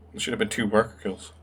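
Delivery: ordinary talk, nothing marked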